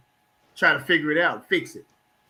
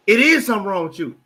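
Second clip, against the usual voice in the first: insistent partner; 5 semitones higher, 7 dB louder, faster than usual. Retold or imitated. Wife insists he is upset.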